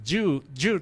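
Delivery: ジュー in an English-like way; foreign pronunciation. Shown as a demonstration. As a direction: say ジュー as one long "joo" syllable. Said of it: "発音を言いますけども".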